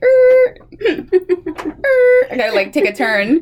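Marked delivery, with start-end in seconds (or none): 0.0-2.3 high laughing groan; a squeaky dismayed groan, laughing. Retold or imitated. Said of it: "I was like".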